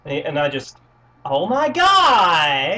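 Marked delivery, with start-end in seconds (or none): 1.2-2.8 put-on drawn-out, higher, louder sorority-girl squeal; mock a drunk sorority girl with stretched words. Drunk sorority girl out at a college bar, mock squeal. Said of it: "That is the word sorority".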